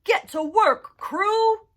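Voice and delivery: loud, high foreman call; a bossy shout to the work crew. Acted. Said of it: "then whistle and shout".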